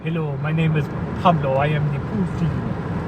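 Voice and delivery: mock Brazilian accent; Pablo. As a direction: introduce yourself in a bad Brazilian accent. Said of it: "I can't even do his accent".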